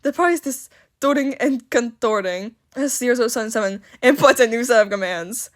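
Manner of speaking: ordinary talk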